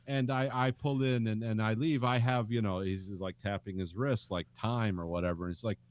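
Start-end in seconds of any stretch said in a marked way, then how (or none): none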